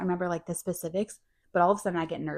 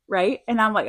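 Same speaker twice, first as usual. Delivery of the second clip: usual speaking voice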